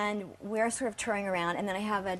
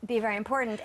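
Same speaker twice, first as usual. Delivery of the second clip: retold brush-off; much faster than usual. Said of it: "I said".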